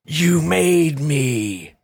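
slow, drawn-out Joker voice; Jack Nicholson impression. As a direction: stretch it out like the Joker. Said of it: "fucking Jack Nicholson, Batman".

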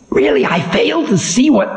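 high, ominous voice of Time; stern ancient Time. Acted. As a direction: warn the demon of doom.

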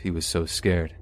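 usual speaking voice

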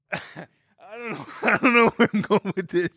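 breaking into laughter; laughing. Real, not acted. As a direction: giggle through the words. Laughs at own bit.

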